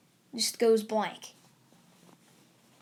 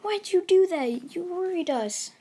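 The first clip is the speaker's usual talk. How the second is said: worried scolding; 7 semitones higher, 4 dB louder than usual. Acted. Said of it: "he's like".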